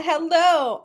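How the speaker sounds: ordinary delivery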